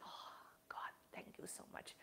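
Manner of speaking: breathless whisper of gratitude to God; whispered thanks. Retold or imitated. Teacher recalling her relief, whispered thanks. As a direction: whisper it like a private prayer of thanks. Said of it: "I am just like".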